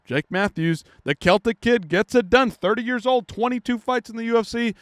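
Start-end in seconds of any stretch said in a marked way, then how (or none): none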